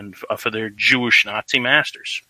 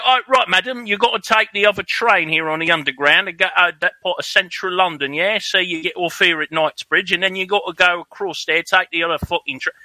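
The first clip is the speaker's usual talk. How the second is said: higher-pitched London accent, imitating a local giving train directions; mimicked London English, high and helpful; British accent; 8 semitones higher than usual. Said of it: "that's a pretty good accent".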